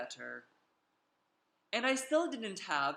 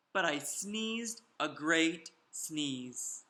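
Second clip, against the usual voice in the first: ordinary delivery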